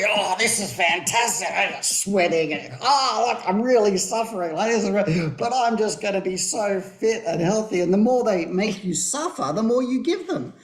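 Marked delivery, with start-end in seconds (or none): 0.1-7.8 lightly voiced mock gym-goer; a hint of a sweaty, proud gym fan; imitating a gym-goer enjoying the pain. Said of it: "the harder you work".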